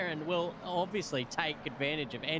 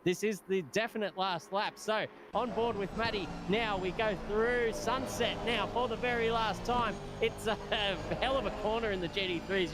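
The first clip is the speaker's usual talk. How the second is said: higher-pitched, flowing race commentary; live play-by-play; 5 semitones higher than usual. Real, not acted. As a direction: follow the chase corner by corner.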